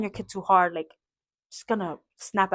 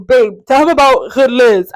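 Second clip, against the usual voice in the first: imitating husband; 3 semitones higher, 13 dB louder, slower than usual. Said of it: "he's like".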